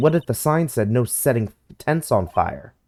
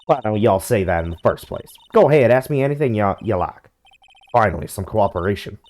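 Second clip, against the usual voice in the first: put-on Southern accent. Southern woman.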